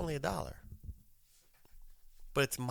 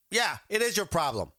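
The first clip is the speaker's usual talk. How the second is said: louder, higher, emphatic comeback; confrontational tone; 8 semitones higher, 5 dB louder than usual. Retold or imitated. Caller insisting to the school lady it's their problem, confrontational. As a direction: snap back with attitude. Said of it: "you know, that tone".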